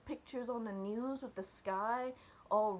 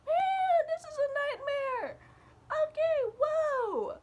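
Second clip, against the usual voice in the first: much higher and louder, a panicked cry; panicked outcry; 7 dB louder than usual. Retold or imitated. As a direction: blurt it out in alarm at the nightmare. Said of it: "And then I'm like".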